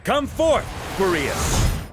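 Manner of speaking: high, ringing call to summon a spirit; commanding summons. Acted. Knight summons the wolf spirit from the sword, ringing call. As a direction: call it out across the field, commanding.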